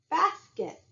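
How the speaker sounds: "basket" with a high first syllable; stress on first syllable. Shown as a demonstration. Teacher giving examples of stressed syllables, "basket" stressed up front. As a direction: hit the start of "basket" high, let the end fall. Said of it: "it's a stressed syllable".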